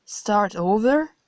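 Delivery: rising, disbelieving echo; incredulous question. Acted. Narrator stunned by God's plan, disbelieving rise. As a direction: repeat it as if you can't believe it.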